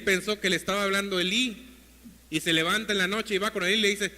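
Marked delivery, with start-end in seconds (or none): none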